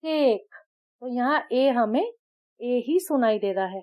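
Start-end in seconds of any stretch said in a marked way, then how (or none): 0.0-0.5 केक with a long ay; long a vowel. Shown as a demonstration. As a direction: stretch the ay in केक. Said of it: "long vowel वाला word बोलूं". (लंबा ए sound)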